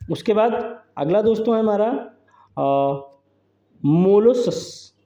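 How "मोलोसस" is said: "मोलोसस" very slow with a long first syllable; drawn-out term. Shown as a demonstration. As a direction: stretch the "मो" in "मोलोसस" to teach it. Teacher sounds out a new term.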